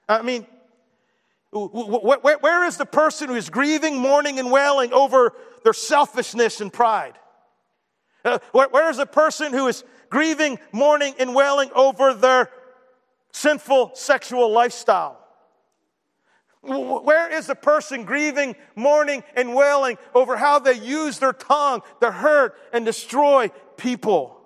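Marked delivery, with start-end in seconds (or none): 1.5-7.2 raised, rising pulpit cry; impassioned preacher, pitched up and pushing; preaching at full force. Acted. 8.2-15.2 raised, rising pulpit cry; impassioned preacher, pitched up and pushing; preaching at full force. Acted. 16.6-24.4 raised, rising pulpit cry; impassioned preacher, pitched up and pushing; preaching at full force. Acted.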